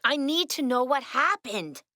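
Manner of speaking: ordinary talk, nothing marked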